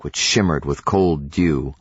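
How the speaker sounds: plain reading voice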